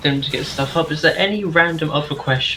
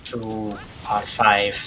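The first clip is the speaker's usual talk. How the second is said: slow dramatic announcement; 5 semitones lower, 5 dB quieter, much slower than usual. Acted.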